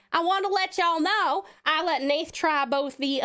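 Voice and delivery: drawling, gushing Southern hostess; Southern accent. Pretend. Streamer thanking and greeting viewers, Southern accent. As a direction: lay on a friendly Southern twang. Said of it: "Was that southern enough?".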